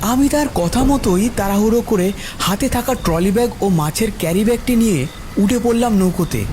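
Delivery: plain reading voice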